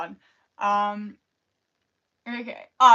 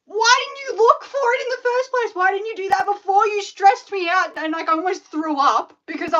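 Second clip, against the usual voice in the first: high-pitched, louder exasperated rant; whining at dad, pitched way up; 9 semitones higher, 5 dB louder than usual. Retold or imitated. Venting at dad for stressing her.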